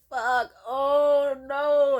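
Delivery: loud, drawn-out cry of pain; re-enacted agonized yell; pained wail. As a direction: wail it out long and high. Acting out collapse in pain.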